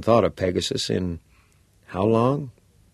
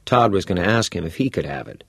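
ordinary delivery, nothing marked

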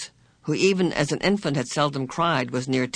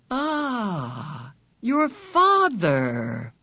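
slow, knowing creepy purr; sinister man in the chair; 4 semitones higher, much slower than usual. A narrator's reading. Man on the chair answers Meg, slow and knowing. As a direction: savour the words like a cat toying with prey.

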